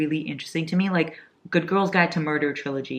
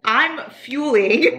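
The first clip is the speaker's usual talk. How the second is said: I'm fueling stretched out very slowly, higher; emphatic; 9 semitones higher, 3 dB louder, much slower than usual. Real, not acted. Insisting to partner over refuel in drawn-out emphasis. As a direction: drag fueling out to win the point. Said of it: "I had no fuel".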